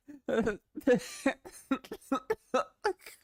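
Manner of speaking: a rising burst of giggles; uncontrollable laugh. Real, not acted. Streamer to chat, giggling at a silly thing. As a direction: laugh till it runs away with you. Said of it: "makes me laugh so much".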